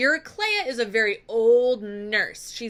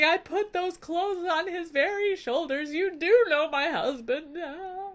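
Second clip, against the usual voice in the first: badly faked sobbing speech; mock crying; 6 semitones higher than usual. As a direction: imitate a weeping wife, pitched up throughout. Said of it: "Penelope starts crying".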